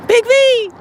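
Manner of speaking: loud, high call across the street. Real, not acted. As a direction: shout the cat's name, high. Calling cat by name.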